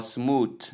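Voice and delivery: "smooth" ending in a hard d or t, like "smood"; mispronounced smooth. Shown as a demonstration. Teacher showing another wrong smooth, mispronounced with a stop. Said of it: "Both pronunciations here are wrong".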